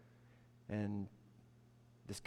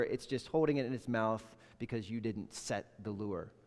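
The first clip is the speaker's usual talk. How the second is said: ordinary talk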